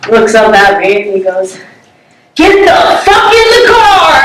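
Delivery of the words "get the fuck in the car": much higher, barked mock yell; imitating the stranger's sudden snarl; sudden angry yell. Stranger in passenger seat yelling at narrator, a much higher angry yell.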